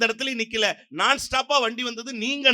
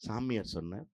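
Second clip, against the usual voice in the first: ordinary talk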